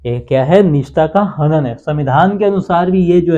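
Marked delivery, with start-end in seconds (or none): none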